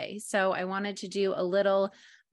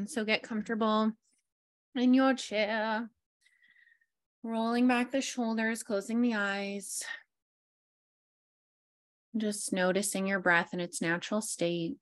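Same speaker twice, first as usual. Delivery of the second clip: guided meditation. Acted. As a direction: ease listeners into their chairs, unhurried. Leads a grounding meditation.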